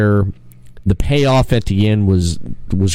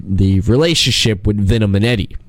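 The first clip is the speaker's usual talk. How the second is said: ordinary delivery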